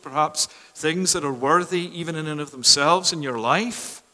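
ordinary delivery

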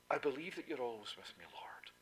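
fast, hushed praying whisper; whispered prayer. Acted. Preacher voicing a doubting believer, whispered. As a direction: pray it under your breath.

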